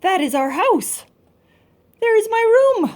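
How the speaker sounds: loud, high thrilled discovery; excited kids. Acted. Child seeing her own room on the plans, high and loud. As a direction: point and squeal at the drawing.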